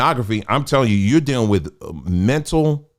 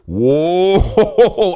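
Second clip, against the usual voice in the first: a higher, louder startled whoa; surprised whoa; 6 semitones higher, 4 dB louder than usual. Retold or imitated. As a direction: gasp it out as if caught off guard. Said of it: "And I was like".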